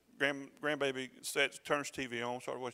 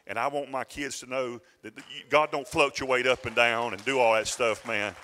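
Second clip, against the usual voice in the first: preaching that builds much louder; forceful preaching; 9 dB louder than usual. Real, not acted. Preacher assuring his people that God does not waver, forceful preaching. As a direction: drive it home, louder toward the end.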